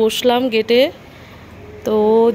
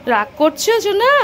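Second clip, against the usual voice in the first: higher, rising sing-song to a pet; 6 semitones higher than usual. Real, not acted. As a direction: sweet-talk a sulking dog. Sweet-talking the sulking dog.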